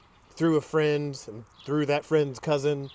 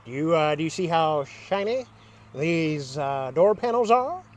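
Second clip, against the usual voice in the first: lightly mimicked woman, halting delivery; imitating a woman's talk. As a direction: mimic her halting question, lifting at the end. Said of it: "this is exactly how she talked".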